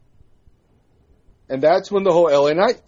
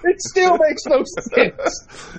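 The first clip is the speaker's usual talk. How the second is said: higher and slow, laughing through the words; laughing disbelief; 12 semitones higher, much slower than usual. Real, not acted.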